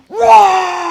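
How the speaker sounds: big, slow, shouted dragon roar; a long, loud demo roar for the children. Shown as a demonstration. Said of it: "let's try it again".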